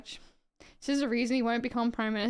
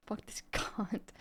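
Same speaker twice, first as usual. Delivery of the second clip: a slow, breathy half-laughed curse under the breath; soft, slow muttered swear with a laugh; 8 dB quieter, slower than usual. Real, not acted. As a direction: swear softly to yourself through a laugh. Disgusted at the politician.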